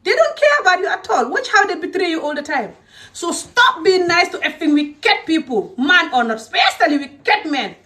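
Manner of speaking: high, emphatic exasperated rant; heated scolding. Real, not acted. Speaker warns women off wicked men, heated scolding. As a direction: pitch it high and hammer the warning.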